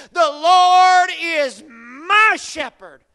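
loud, slow, ringing declaration; bold proclamation. Shown as a demonstration. Believers proclaiming their faith, loud and slow. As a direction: proclaim it boldly. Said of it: "boldly proclaim".

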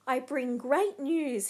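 ringing, slightly drawn-out proclamation; herald's announcement. A narrator's reading. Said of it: "he announced".

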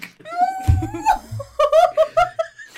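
high, loud laughing fit; burst of laughter. Real, not acted. Teller bursting out laughing at the story. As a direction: crack up at the memory.

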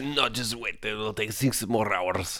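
soft, odd put-on voice as the makeup man; put-on Rob Schneider voice. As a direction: calmly tell him there are hours still to go, in a changed voice.

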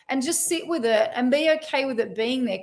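ordinary talk, nothing marked

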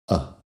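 "a" said very short and light, almost swallowed. Shown as a demonstration. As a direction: clip the "a" down to a quick weak vowel. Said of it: "Así de corta".

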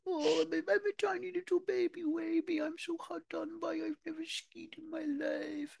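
mock poor-little-me voice, very high and soft; Danny impression. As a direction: squeak it out as a hard-done-by baby. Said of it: "so that's my little".

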